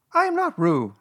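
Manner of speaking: high, loud little protest; loud Piglet. A narrator's reading. Piglet correcting Kanga at bath time, loud. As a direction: insist loudly in a small high voice. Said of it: "said Piglet loudly".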